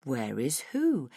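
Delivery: low, slow mock innocence; unhurried, teasing villain; taunting villain. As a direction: drag it out low, playing dumb. Villain feigns ignorance.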